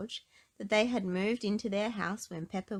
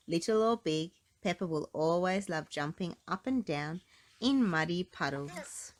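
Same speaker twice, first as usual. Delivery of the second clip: plain reading voice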